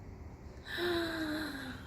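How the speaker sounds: soft dramatic gasp; hushed storyteller gasp. Acted. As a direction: gasp for the kids. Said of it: "reads aloud to children".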